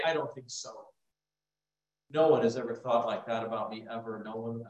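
low, hushed self-doubt; dejected reply. Acted. Listener doubting God's thoughts about them, quietly dejected. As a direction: drop low and soft, unconvinced.

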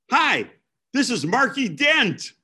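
high, slow Milwaukee caller voice; Milwaukee accent. Retold or imitated. Old friend phoning the preacher, Milwaukee accent, high and slow. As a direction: put on a cheery Milwaukee accent, drawn out. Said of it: "this Milwaukee accent".

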